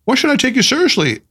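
high-pitched playful challenge; lightly teasing question; playful twinkle. A narrator's reading. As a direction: tease with a twinkle, pitch lifted. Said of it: "with a twinkle in his eye".

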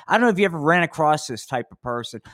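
ordinary talk, nothing marked

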